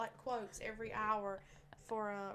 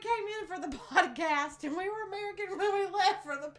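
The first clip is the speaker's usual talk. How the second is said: laughing; 7 semitones higher, 9 dB louder than usual. Real, not acted. Laughing retelling of silly moment.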